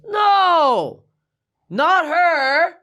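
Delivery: high, drawn-out imitated holler; calling out. Woman behind counter correcting the guard, high drawn-out shout.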